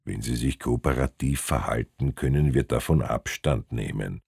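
low, soft conciliatory voice; mild-mannered official; friendlier inspector. A narrator's reading. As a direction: offer a way out, low and quiet. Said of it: "Noch immer war Hirm der Freundlichere".

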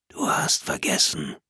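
slow, menacing hoarse whisper. Acted. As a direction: breathe it like a hidden intruder. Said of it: "ein heiseres Flüstern". (heiseres Flüstern)

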